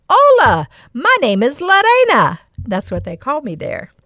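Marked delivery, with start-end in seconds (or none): 0.1-2.4 high, loud and cheerful Hola; bright Spanish greeting. Retold or imitated. Greeting a girl in Honduras, high and bright. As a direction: greet her brightly, pitched way up. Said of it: "I just go".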